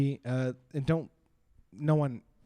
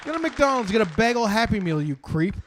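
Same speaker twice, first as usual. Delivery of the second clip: mock outraged rant; 5 semitones higher, 5 dB louder than usual. Rant continues.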